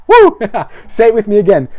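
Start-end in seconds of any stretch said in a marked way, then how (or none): 0.1-0.4 high, loud "woo" of celebration; excited whoop. Real, not acted. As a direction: whoop sharply to cheer the crowd on. Preacher whoops after congregation response.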